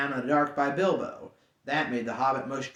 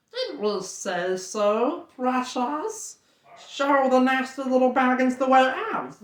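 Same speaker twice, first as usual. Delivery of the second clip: high, hissing creature voice; wheedling Gollum, pitched high; 8 semitones higher, slower than usual. Acted. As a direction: croon it as a slimy cave creature talking to itself. Gollum evading.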